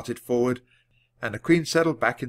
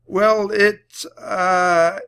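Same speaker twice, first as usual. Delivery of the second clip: nervous stammer; 9 semitones higher, 7 dB louder, much slower than usual. A narrator's reading. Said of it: "Jack stammered".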